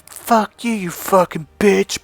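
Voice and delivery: high-pitched, drawn-out mock outburst; mock angry insult. Friend lashing out at girl who ignored him, mock angry. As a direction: imitate an irate friend cursing someone out.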